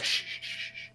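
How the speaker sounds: soft, long shushes; mum shushing. Retold or imitated. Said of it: "the mum's like".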